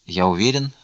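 usual speaking voice

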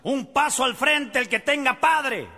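shouted parade-ground orders, high; drill sergeant. Acted. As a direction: bellow at the troops on parade. Sergeant yells at the troops.

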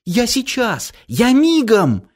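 high, eager and hurried; excited child. Acted. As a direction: squeal it, ready to run. Girl rushes off excitedly.

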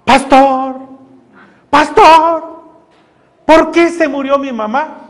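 lightly voiced pleading church sister; hermana asking the pastor. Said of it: "me dice la hermana".

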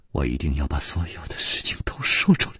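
fast, unvoiced whisper; mournful whisper. 柳无眉凄然低语要说出一切. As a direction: whisper it in grief. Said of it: "柳无眉凄然说".

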